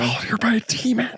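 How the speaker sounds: fast frightened whisper. Acted. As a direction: hiss it in terror. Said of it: "quiet down".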